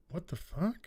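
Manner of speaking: muttered under the breath, soft; confused cinema-goer whispering; stunned hush in a dark cinema; hushed disbelief. Retold or imitated. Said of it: "people going".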